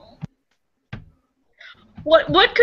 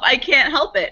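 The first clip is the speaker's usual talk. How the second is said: ordinary talk, nothing marked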